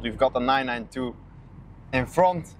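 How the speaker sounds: ordinary talk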